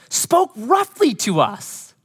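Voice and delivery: slow, high, whining tattletale voice; whiny complaint. Acted. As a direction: stretch it out like sulking grown sons. Brothers whining to their father.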